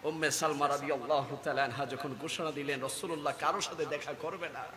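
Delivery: low, very soft telling; hushed narration. A narrator's reading. Preacher tells of Umme Salma's announcement, hushed. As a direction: sink almost to a murmur. Grave narration of last hours.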